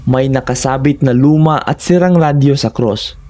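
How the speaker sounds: plain reading voice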